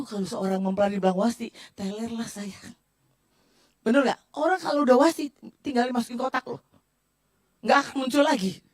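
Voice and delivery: ordinary delivery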